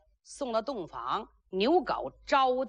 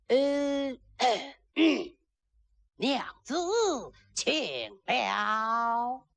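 throat-clearing, drawn-out stage courtesy; refined, courtly greeting; much slower than usual. Acted. Nervous groom greeting his bride, drawn-out refined courtesy. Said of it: "还挺斯文的呢".

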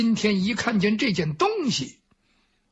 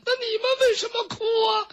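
comic sobbing; 14 semitones higher, faster than usual. Acted. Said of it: "他们在那抽抽搭搭的哭".

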